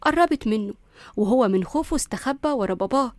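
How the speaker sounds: ordinary delivery, nothing marked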